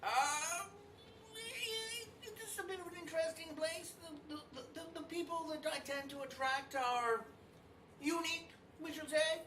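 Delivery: high, prim French chef voice. Acted. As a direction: speak airily as a fussy host. GM voices Archibald to guest.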